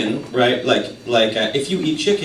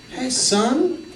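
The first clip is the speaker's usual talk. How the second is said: a hint of a dad showing his son an animal, high, slow at the end; nature-guide dad voice, lightly played; mock parent pointing; 6 semitones higher, slower than usual. Dad to son at the zoo, lightly mock-amazed.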